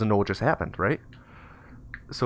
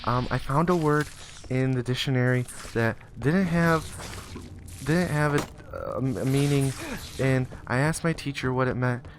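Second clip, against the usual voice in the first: put-on higher, hesitant child voice; halting, composed little-boy voice; boy collecting himself; 5 semitones higher than usual. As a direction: explain carefully like a nervous kid. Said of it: "collects himself".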